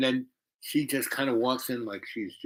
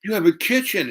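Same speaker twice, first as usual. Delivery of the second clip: higher, louder imitation of an amazed girl; mock wide-eyed wonder, pitched up; 9 semitones higher, 5 dB louder than usual. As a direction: marvel at it in a raised voice. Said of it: "She goes".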